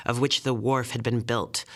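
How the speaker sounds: usual speaking voice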